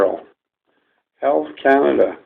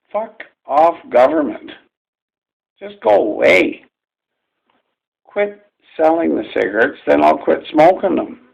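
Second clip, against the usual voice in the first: loud, higher, fed-up yelling; angry outburst; 5 semitones higher, 4 dB louder than usual. Real, not acted. A smoker venting at the government, angry shout. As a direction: tell the government to get lost, furious.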